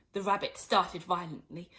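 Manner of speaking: ordinary delivery, nothing marked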